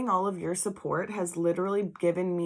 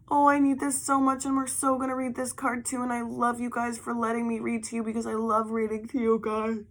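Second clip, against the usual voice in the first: excited; 5 semitones higher than usual. Real, not acted. Thrilled at drawn card.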